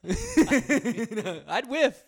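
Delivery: loud high laughing. Real, not acted. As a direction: laugh through it loudly. Laughing at own joke.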